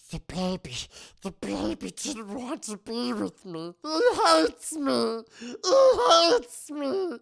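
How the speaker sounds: hammed-up crying wail; mock sobbing. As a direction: blubber like a rejected old man. Rumpelstiltskin sobbing over baby.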